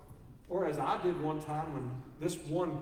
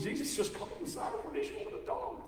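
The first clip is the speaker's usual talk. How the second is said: much higher, softer mock gossip voice; mock tweet; 11 semitones higher, 4 dB quieter, faster than usual. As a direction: read it like a scandalized tweeter. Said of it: "Can you imagine somebody tweeting".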